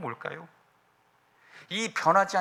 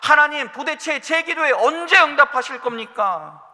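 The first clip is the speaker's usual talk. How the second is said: higher, louder pleading complaint; frustrated cry to God; 7 semitones higher, 6 dB louder than usual. Acted. A believer crying out to God in frustration, loud and high. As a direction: cry out at God, demanding an answer.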